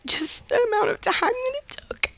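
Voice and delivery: crying, voice thinning to a high whisper; real tearful emotion. Real, not acted. Caller telling hosts how long paying off debt took, tearful. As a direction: squeeze the words out through tears. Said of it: "where that emotion comes from".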